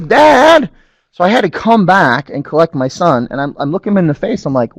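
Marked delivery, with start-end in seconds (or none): 0.0-0.7 put-on high kid's plea; a small boy stopping his father; little son calling Dad; child insisting.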